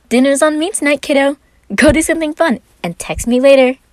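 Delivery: high, loud cheerful brother's note; note voiced.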